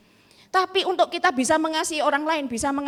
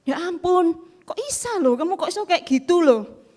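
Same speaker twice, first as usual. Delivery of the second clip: higher, louder dismayed exclamation; shocked disbelief; 5 semitones higher, 6 dB louder than usual. Retold or imitated. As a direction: cry out in disbelief, pitch up. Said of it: "Kaget saya".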